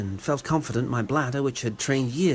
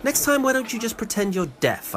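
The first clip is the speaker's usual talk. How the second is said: indignant retort; 10 semitones higher than usual. Acted. Imagined retort to the woman.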